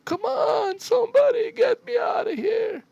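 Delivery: high-pitched mock wail of a trapped coward; mocking a panicked cry for help. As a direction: whine like a scared kid stuck in an elevator. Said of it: "cry like a little bitch".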